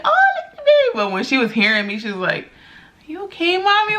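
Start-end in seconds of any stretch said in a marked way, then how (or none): 0.0-0.7 put-on high, louder child's voice; excited little girl. 2.5-4.0 put-on worried little girl. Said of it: "Like that".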